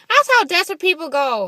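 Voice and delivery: high, loud protest; indignant outburst. Retold or imitated. Pissed-off daughter reacting to mom's dating profile, indignant. Acting out her first annoyed reaction.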